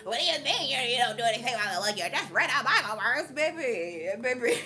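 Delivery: loud, mocking voice of a scolding critic; a hammed-up imitation of a Bible-quoting critic. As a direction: play the nitpicker who thinks they caught you out. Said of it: "somebody was like".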